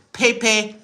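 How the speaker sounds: high mock leader voice; badly faked, high-pitched impersonation; Xi Jinping. Streamer imagines Chinese leader turning round, high mock voice.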